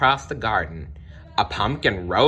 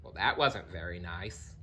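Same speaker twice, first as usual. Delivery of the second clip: much softer, lower disapproving aside; quiet aside; 3 semitones lower, 11 dB quieter than usual. A narrator's reading. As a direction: murmur it to the listener.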